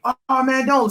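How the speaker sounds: high, pleading barfly voice; mock the guy begging him to stay; lightly voiced pushy drunk; pushy stranger. Bar stranger voiced in story.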